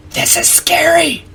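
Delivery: very high, drawn-out falsetto squeal; mock fright. Player hiding from the monster, squealing in mock fright. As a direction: squeak it slowly in exaggerated fear.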